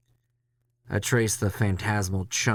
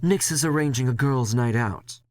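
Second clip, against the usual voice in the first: Leslie, boss; 5 semitones higher than usual. Acted. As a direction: play the boss breezily dismissing it. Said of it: "he says".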